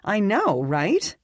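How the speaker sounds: high, bright burst of shared excitement; excited. A narrator's reading. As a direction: gush it out, thrilled. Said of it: "as excited as the dog".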